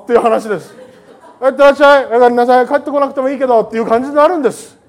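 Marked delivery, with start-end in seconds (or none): 1.4-3.6 大きな声で、投げやりな妻のように; jaded wife. Retold or imitated. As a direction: send him off loud, not caring if he returns. Jaded spouse sending partner off. (夫を見送る妻の、大声の投げやりな口調)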